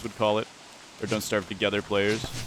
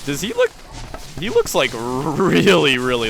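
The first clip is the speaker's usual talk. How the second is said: surprised; 5 semitones higher, 5 dB louder than usual. Real, not acted. Repeats astonishment.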